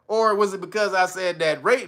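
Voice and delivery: ordinary talk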